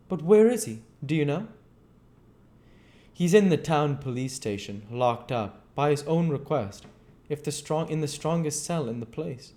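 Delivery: cautious doctor probing gently; lightly voiced, careful questioner; a hint of wary Kemp. Kemp answers.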